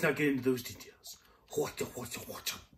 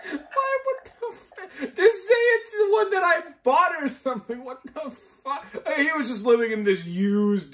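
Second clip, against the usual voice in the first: disgusted outrage; 11 semitones higher, 5 dB louder than usual. Real, not acted. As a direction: shriek half laughing in disgust. Outraged at the joke.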